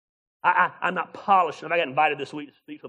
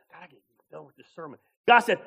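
normal talking voice

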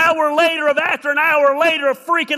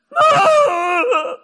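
loud, high cackling laugh; burst of laughter; 9 semitones higher, 5 dB louder than usual. Real, not acted. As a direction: crack up at the memory. Reacting to wife's threat.

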